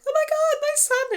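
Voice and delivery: very high, joyous squeal; overjoyed cry. Retold or imitated. Cleo reaching her little boy, a very high joyful squeal. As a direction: shriek with joy at finding her son. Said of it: "which Cleo is like".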